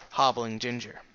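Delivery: ordinary delivery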